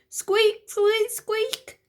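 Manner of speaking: high squeaking pram wheel; shrill high wheel squeaks; squeaky wheel noise. A narrator's reading.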